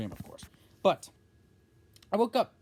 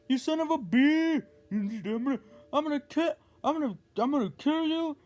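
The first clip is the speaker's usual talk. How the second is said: angry threat; 5 semitones higher than usual. Retold or imitated. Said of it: "cussing a lot".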